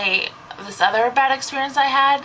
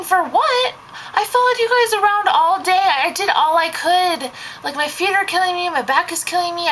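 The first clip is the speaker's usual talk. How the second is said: indignant inner rant; 7 semitones higher than usual. Retold or imitated. Babysitter venting at the family who gave no tip, pitched up and exasperated. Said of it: "I'm thinking".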